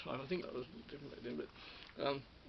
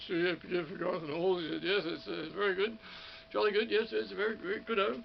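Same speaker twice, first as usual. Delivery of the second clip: louder, raised big radio voice; Bruce McKinley; 4 semitones higher, 6 dB louder than usual. Retold or imitated. Radio man approving of the studio producer, big radio voice. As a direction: imitate a hearty radio man, projecting. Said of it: "he had a big radio voice".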